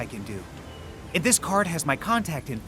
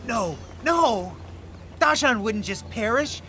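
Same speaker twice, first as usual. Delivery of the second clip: upset denial; 7 semitones higher than usual. Acted. Refuses to believe friend died.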